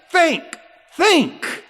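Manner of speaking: high, loud punched repeats; emphatic shout. Real, not acted. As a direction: hammer the word home from the pulpit. Hammers the word repeatedly.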